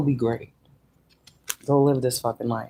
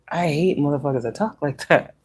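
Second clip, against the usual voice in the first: normal talking voice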